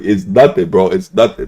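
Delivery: ordinary talk, nothing marked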